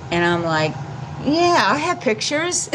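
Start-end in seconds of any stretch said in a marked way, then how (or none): 1.2-2.7 much higher, slow eager yes; much higher, slower mock-eager reply to hide ignorance; eager bluff. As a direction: bluff a confident yes, pitched way up. Nervously bluffing to the dungeon.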